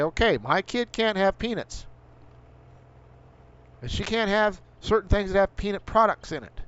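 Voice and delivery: fed-up, indignant ranting; angry rant. Real, not acted. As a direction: hammer at the government's rules. Ranting about government overprotection.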